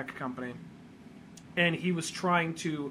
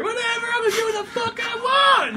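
much higher, fast, shouted brat voice; bratty Sonic shout; 9 dB louder, faster than usual. Retold or imitated. Sonic brushes off the kid, high fast bratty shout. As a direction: blow him off and bolt. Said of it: "Sonic defies the kid".

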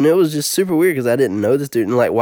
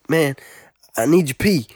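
lightly put-on street accent, raised pitch; imitation of classmate's accent; 5 semitones higher than usual. Classmate begging a near stranger for help, imitated accent. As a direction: plead in a rough imitation of his accent. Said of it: "doing my best imitation of his accent".

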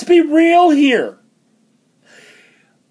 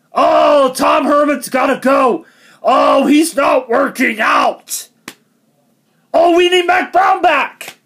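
yelled whiny fan quote; mocking shout; 2 semitones higher than usual. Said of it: "what people said".

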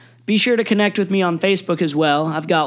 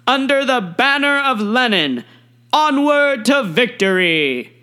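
ranting dictator speech; 7 semitones higher, 3 dB louder than usual. Retold or imitated. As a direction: proclaim it like a rally speech. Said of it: "Stalin's closing remarks were as follows".

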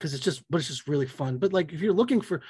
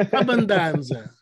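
hammed-up Italian, slow and louder; big mock Italian exclamation; booming sing song welcome; 3 semitones higher, 4 dB louder, slower than usual. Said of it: "I knew you were gonna do that too".

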